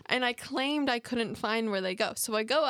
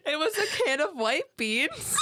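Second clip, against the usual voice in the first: high, loud, laughing shout; laughing hard; 11 semitones higher, 5 dB louder than usual. Real, not acted. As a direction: laugh it out, embarrassed. Laughing at own story.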